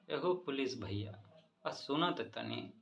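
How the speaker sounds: ordinary delivery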